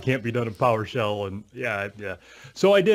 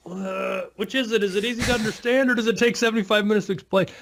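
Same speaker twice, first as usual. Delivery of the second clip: mock-exasperated, higher pitched challenge; a pitched-up which-is-it jab; incredulous; 10 semitones higher than usual. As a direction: poke at the contradiction in disbelief. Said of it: "you're like".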